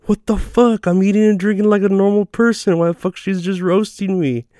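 high-pitched mock indignation; indignant. Teased diner complaining to his buddy, indignant. Acted indignant reply to teasing waitress.

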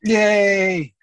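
loud, high cheer; excited cheer. Real, not acted. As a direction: cheer with joy. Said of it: "that excited voice over there".

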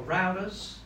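American-accented "routers", first syllable rhymes with "cow"; American pronunciation. Shown as a demonstration. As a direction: say "routers" the American way, ROW-ters. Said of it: "if you're American".

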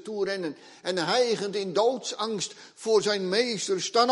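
high, loud, driving sermon voice; urgent pulpit delivery; fiery preaching. Acted. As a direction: preach it with full force to the congregation. Preaching at full force.

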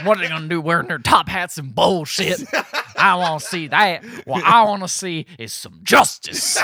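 hammed-up patriot demanding satisfaction, slowing at the end; indignant mock yokel. Railing at British top hats, mock yokel indignation.

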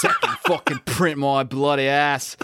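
laughing put-on British accent sliding into Australian; mock British accent. Podcaster mocking Alan Moore, in a laughing British-Australian accent. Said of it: "my British is getting more and more Australian".

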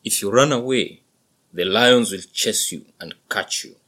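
loud, patient father bull; explaining buffalo father. A narrator's reading. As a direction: explain it loudly to your son. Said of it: "The dad smiled and explained".

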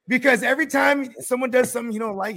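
usual speaking voice

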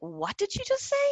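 high, put-on voice of shocked disbelief; baffled question. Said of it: "and I'm like".

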